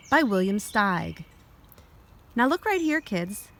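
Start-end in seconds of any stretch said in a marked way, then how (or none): none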